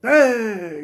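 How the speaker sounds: short high shout, imitating a hyped preacher; a hammed-up imitated preacher's cry; mock preacher yell. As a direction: belt it like a preacher working the crowd. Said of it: "empieza a los gritos limpios".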